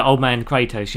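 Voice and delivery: ordinary talk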